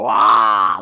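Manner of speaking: sudden loud mock vampire roar; imitated monster scare, long and open; Dracula roar. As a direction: pounce with a loud Dracula "wah". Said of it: "this Dracula thing go".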